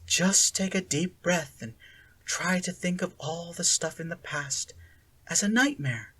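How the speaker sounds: high, soft soothing coaxing; calm her down breath by breath; reassuring. A narrator's reading. Sally Ann calming the collapsing Akira, soft. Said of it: "with a reassuring hand and said".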